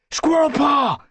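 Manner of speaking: high, loud call across debris. Acted. Brambleclaw calling for Squirrelpaw under branches, loud call. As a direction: call her name to find her.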